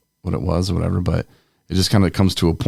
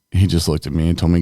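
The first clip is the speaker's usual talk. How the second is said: usual speaking voice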